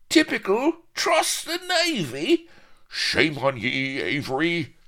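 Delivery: high, loud chorus of put-on crew voices; disgusted pirates. Pirate crew turns on the captain, loud disgust. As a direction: heckle him in outrage, voice leaping up. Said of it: "Murmurs of disgust arose from the pirates".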